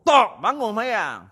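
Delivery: slow drawn-out wake-up call through the door. Retold or imitated. Preacher knocking on his brother's door, slow wake-up call. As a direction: drag it out, calling him up to pray.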